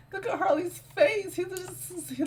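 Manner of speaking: very high amazed squeal. Real, not acted. Gamer reacts to the visitor's face, very high amazed squeal. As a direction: squeal at what you see.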